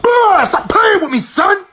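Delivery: loud, high, drawn-out outburst; comic confrontation. Acted. Storyteller about to punch a charging elephant, comic confrontation. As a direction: shout a stretched high warning.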